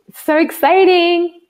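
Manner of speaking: high, loud excited cry; squealing excitement. Real, not acted. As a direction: burst out with joy. Excitement for winner.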